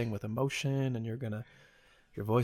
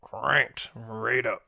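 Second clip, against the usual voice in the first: slow drawn-out; 5 dB louder, much slower than usual. Pretend.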